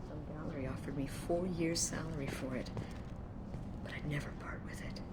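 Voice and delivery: hushed and tender; soft fading whisper. Acted. Mother about daughter's gift.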